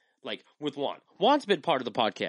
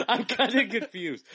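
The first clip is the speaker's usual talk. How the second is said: laughing; 9 semitones higher, slower than usual. Real, not acted. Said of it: "I'm kinda confused on what he".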